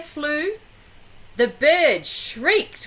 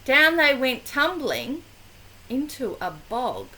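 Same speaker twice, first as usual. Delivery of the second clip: usual speaking voice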